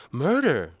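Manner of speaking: high, loud shocked cry; outraged exclaim. A narrator's reading. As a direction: gasp it out in outrage. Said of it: "exclaims with the appropriate outrage".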